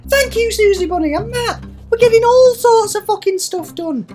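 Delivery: very high, giddy squeal of a voice; excited. Real, not acted. Player delighted by progress, high giddy excitement. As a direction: celebrate in a silly high pitch.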